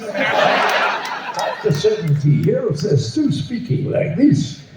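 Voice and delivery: slow, exaggerated French accent; heavy mock French accent, drawn out. Shown as a demonstration. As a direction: speak English like a Frenchman who never learned it. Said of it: "You want a French accent?".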